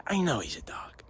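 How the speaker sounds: whispered under the breath. Real, not acted. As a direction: mutter it to yourself. Repeat under his breath.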